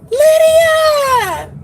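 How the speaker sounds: high, loud joyful call of a name; excited shout. Real, not acted. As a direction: call out to a friend, thrilled. Calls out to friend on seeing her.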